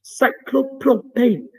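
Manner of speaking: put-on slow, high Welsh accent; a hint of a Welsh lilt. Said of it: "I'm not very good at the Welsh accent".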